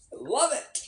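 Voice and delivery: high, sarcastic "love it" after phone trouble. Real, not acted. Streamer to viewers about her broken phone, sarcastic. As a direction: spit it out with mock delight. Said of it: "I'm fucking sarcastic".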